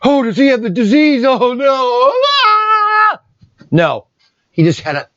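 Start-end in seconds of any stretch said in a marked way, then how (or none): 0.0-2.1 mock panicked onlookers. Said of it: "Everybody's looking at us like". 2.1-3.2 mock panicked scream. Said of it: "Everybody's looking at us like".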